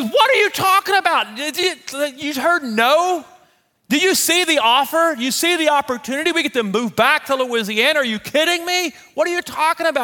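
husband mimicking wife's outraged questions; imitating wife yelling. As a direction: play Pam yelling in high disbelief. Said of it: "Now I got Pam yelling".

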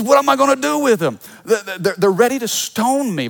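exasperated leader venting about his people; Moses complaining. Retold or imitated. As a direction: play Moses throwing up his hands to God, fretful and quick. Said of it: "Moses starts complaining to God. He says".

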